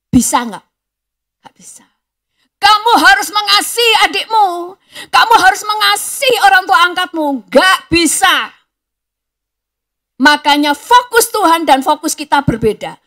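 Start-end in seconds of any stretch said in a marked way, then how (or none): 1.4-1.9 breathy whisper, almost silent. Acted. Preacher answers his own question. 2.6-7.3 much higher and louder, an order; commanding shout. Acted. Voicing adults commanding the child. 7.5-8.5 loud, slow, flat no; loud refusal. Acted.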